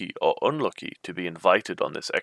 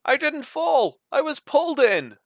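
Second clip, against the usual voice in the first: much higher, louder alarmed shout; urgent yell; 5 dB louder than usual. A narrator's reading. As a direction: shout up that something pulled you. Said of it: "you yell".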